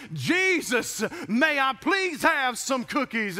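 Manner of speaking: put-on high pleading child voice; yelling child plea. Boy yelling in the store for cookies, high. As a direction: cry out to Jesus like a boy. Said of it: "yelling to the top of his voice".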